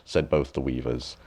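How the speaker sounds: ordinary delivery, nothing marked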